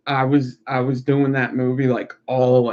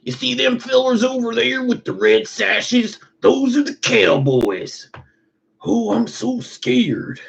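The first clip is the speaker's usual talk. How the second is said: high, put-on Western movie voice; mock Tombstone voice; 10 semitones higher than usual. Quoting the Tombstone movie, in a high, hammed-up Western voice. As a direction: ham it up like the film. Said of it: "That was, like, exactly".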